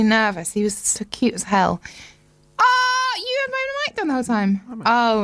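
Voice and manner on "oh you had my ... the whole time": surprised. Real, not acted. Mic was down.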